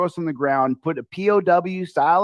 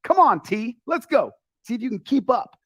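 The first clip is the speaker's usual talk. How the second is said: higher-pitched urging, as retold; lightly acted challenge to a buddy; quoting himself; 8 semitones higher than usual. Telling his friend to keep up on stairs, higher urging voice. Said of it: "And I'd be like".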